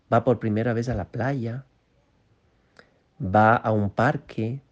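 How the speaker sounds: normal talking voice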